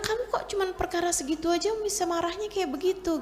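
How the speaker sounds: puzzled husband. Retold or imitated. Husband questions his easily angered wife.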